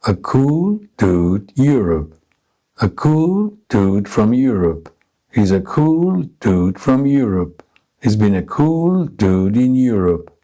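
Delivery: even beats on "cool", "dude", "Europe", small words squeezed between; stress-timed rhythm drill. Shown as a demonstration. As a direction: hit "cool", "dude", "Europe" at equal spacing, little words unstressed. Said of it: "compressing the function words without any stress".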